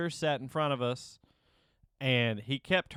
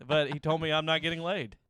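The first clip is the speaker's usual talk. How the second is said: ordinary talk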